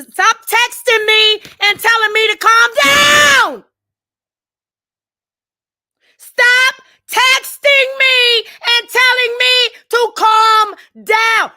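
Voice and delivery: slow, high, full-volume shout; loud, exasperated screaming demand; furious yelling. Real, not acted. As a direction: scream it word by word. Yelling at friends telling her calm.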